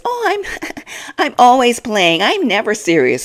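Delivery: high, laughing mock innocence; smirking mock Brian. Vlogger mocks Brian's smirking denial, high and giggly. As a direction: play the smug joker, giggling. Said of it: "smirking all the while".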